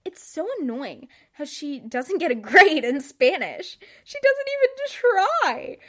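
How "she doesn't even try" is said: high, whiny mock outrage, much higher; a sulky preteen griping about a classmate. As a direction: whine it like a fed-up kid. Mocking old diary outrage.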